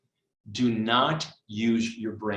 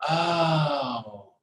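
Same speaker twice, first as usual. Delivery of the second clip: long drawn-out, higher "oh"; dawning realization; 4 semitones higher than usual. Acted. Student reaches the bottom of the problem, dawning realization. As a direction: let understanding dawn in one stretched sound. Acted moment of understanding.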